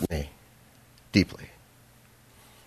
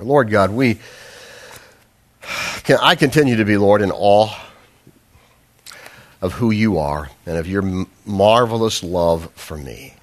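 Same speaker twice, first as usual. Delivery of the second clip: prayer; 4 semitones lower than usual. Acted. Leads closing prayer.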